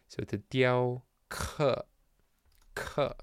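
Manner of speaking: slow "diao ke" with a level, first-tone "ke"; slow, unsure first-tone "ke"; tone check. Shown as a demonstration. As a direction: draw out "ke" testing a flat tone. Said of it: "I really feel like that was first tone".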